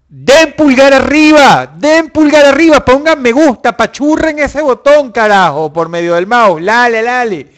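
high, loud shouted plea; shouting. Real, not acted. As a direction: yell at the viewers to hit like. Exige pulgares a gritos. (gritándole a los espectadores que den like, agudo y fuerte)

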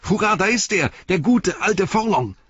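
put-on high, loud cheering boy. A narrator's reading. Boy cheers Forlong's arrival, high and loud. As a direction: cheer with all your breath.

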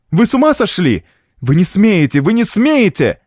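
high, urgent outraged protest; desperate cry. A narrator's reading. Niece held back by a man, desperate cries. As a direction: cry out against him desperately. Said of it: "в отчаянии закричала Франсуаза".

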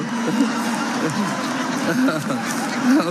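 a lingering low chuckle; laugh winding down. Real, not acted. Preacher still chuckling after his jokes, lingering laugh. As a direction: let the laugh trail off.

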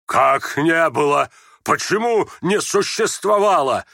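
loud, slow, rising outrage; menacing. Acted. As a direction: round on your son, stretching the challenge. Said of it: "грозно вскинулся он на сына". (грозно, с нарастающим гневом)

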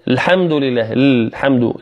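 "الحمد" begun on a bare "l": "lhamdu"; dropped hamza. Shown as a demonstration. Teacher shows a mistake, "الحمد" without its hamza. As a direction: start "الحمد" straight on the lam. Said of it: "لا يذكر الهمزة".

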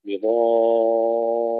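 بضارين with the alif held six counts and a light doubled ra; six-count madd. Shown as a demonstration. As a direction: hold the alif of بضارين long before the ra. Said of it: "ست حركات مع تشديد الراء". (مد لازم ست حركات في بضارين)

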